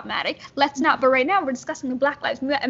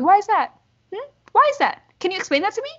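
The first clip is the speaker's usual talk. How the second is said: angry confrontation; 6 semitones higher than usual. Acted. Confronting a social worker over her bias, angry and insistent. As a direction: press for an answer, pitch climbing.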